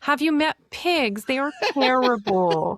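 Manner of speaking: high, exasperated disbelief; incredulous retort. Retold or imitated. Answering pig romantics, high exasperated scoff. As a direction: scoff at their naive pity. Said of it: "And I'm like".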